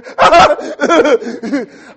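big burst of laughter; loud laugh. Real, not acted. Laughing at his younger self, loud burst.

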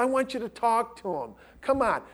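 retold coaxing, pitched well up; a friend pressing him to come along; pleading friend. Friend on the phone urging Chris to meet John, retold high and coaxing.

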